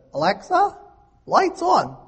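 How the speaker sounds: high, squawky parrot calling out to Alexa. Retold or imitated. Preacher imitating a parrot ordering Alexa, high squawk. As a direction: play the parrot squawking its command. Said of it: "Petra the parrot says".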